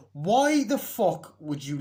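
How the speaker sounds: loud, high, slow outburst; incredulous rant; exasperated. Real, not acted. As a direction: demand it in disbelief, stretching each word. Ranting about a dumb plot point.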